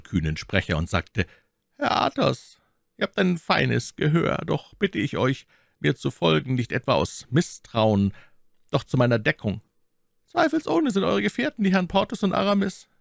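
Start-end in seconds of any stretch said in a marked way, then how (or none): 1.8-12.9 lightly voiced cardinal, smooth and higher; shrewd cardinal. A narrator's reading. Cardinal requesting escort, lightly voiced and smooth. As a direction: flatter and command at once.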